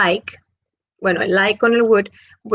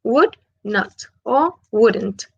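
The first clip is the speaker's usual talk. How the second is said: slow, careful Would not, then wouldn't; slow contrast of full and contracted form; much slower than usual. Shown as a demonstration. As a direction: spell out the contraction slowly. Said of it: "Es como una contracción".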